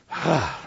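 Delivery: low, weary grunting sigh; frustrated grunt. Retold or imitated. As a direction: let out a fed-up groan instead of words. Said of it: "I just grunted".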